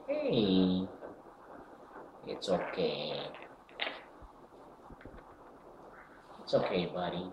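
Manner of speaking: soft, gentle reassurance for a pet; calm a nervous little bird softly; soothing pet voice. Real, not acted.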